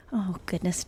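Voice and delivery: normal talking voice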